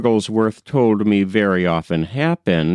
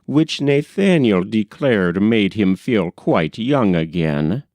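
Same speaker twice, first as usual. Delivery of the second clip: plain reading voice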